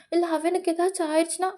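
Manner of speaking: high, quick anxious question; acted alarm, rushed and high; worried protest. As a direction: blurt out the worry, pitched high.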